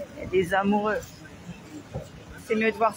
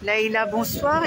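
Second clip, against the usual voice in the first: ordinary delivery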